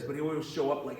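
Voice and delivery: normal talking voice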